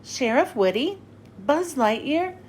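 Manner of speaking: ordinary delivery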